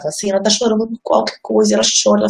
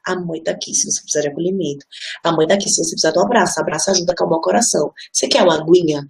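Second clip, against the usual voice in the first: soothing mother; 4 semitones lower than usual. Retold or imitated. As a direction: settle her down with a hushed voice.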